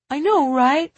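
put-on high, loud rising kid's question; high-pitched, singsong agreement; child's catchphrase. A narrator's reading. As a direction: chirp it from another room. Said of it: "Timby said from his room".

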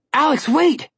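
calling after her, loud and high; shouted order. Acted. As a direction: yell at her to stop. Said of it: "Connor ordered".

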